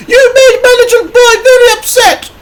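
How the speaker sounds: very high, loud angry mother voice; outraged mum telling someone off; cross shout. A narrator's reading. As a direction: shout it crossly in a piercing falsetto. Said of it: "she shouted crossly".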